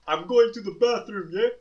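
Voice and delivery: imitating a guy yelling from upstairs; retold yell. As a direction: bellow it across the house.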